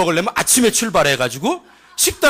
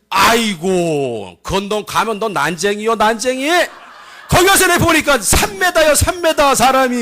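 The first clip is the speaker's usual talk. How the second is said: Chungcheong dialect. Acted. Spy warns of giants in Canaan, excited Chungcheong accent. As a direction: exaggerate wildly in Chungcheong speech. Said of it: "충청도 버전으로".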